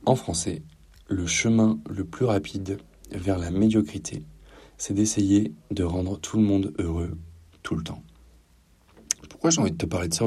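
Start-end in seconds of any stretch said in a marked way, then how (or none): none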